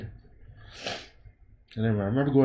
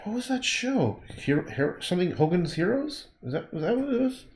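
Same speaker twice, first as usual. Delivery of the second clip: puzzled recall; 8 semitones higher, 3 dB quieter than usual. Real, not acted. Trying to recall show title.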